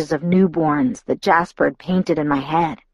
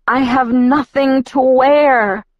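moaning; 5 semitones higher, 3 dB louder, slower than usual. A narrator's reading. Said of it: "I moaned to myself".

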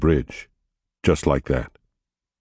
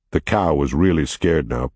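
ordinary delivery, nothing marked

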